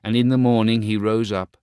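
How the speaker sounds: ordinary delivery, nothing marked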